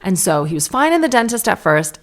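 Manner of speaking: normal talking voice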